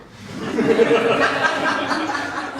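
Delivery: high laugh bursting out; can't hold back the laugh; real laughter. Real, not acted. Poet laughing at his own joke mid-recital, laughing.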